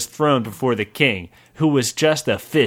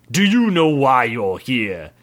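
stern king voice. Acted. King questions prisoner, voiced.

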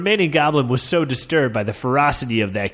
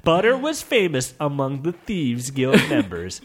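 ordinary delivery

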